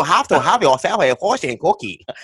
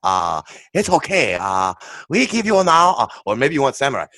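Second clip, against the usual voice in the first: mock Chinese accent; 6 semitones lower than usual. Offering the foreigner things in a mock Chinese accent. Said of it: "we're on the topic of accents".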